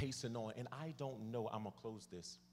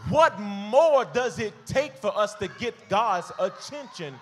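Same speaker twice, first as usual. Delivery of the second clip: loud, raised sermon shout; full-force preaching; 6 semitones higher, 14 dB louder than usual. Acted. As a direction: drive it home to the congregation at full voice.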